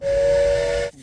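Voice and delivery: high loud stretched whoosh; train whistle sound. Acted. Reader mimics the train speeding away, high and loud.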